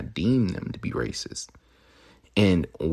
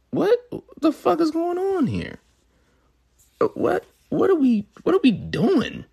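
much higher, louder disbelief; incredulous; 4 dB louder than usual. Real, not acted. Host reacting to a lyric he just heard, incredulous. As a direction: react like you can't believe your ears.

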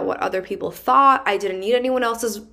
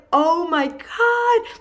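much higher, drawn-out oh my God; slow amazed gasp; 9 semitones higher, much slower than usual. Retold or imitated. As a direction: boss stretching out his amazement. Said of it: "He's like".

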